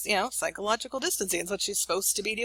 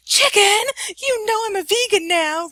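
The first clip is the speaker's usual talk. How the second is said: much higher, much louder indignant shriek; outraged teen; 14 semitones higher, 9 dB louder than usual. Retold or imitated. Daughter shrieking at mom over chicken, higher, louder. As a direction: explode like a teen insulted by dinner.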